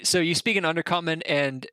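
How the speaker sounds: usual speaking voice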